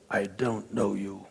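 curt low stonewalling; cold low reply. Retold or imitated. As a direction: brush him off, low and quiet. Chief facing down the ambassador.

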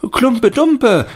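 put-on high, loud, slow child's shout; children shouting. A narrator's reading. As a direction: yell the title like a child. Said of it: "schrien andere".

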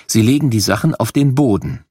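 ordinary delivery

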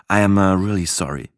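usual speaking voice

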